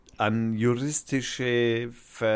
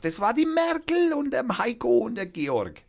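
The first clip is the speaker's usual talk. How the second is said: mock high plea; 12 semitones higher than usual. Imagined court plea, raised voice.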